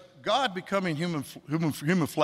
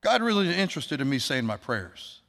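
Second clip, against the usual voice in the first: usual speaking voice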